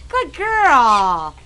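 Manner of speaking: normal talking voice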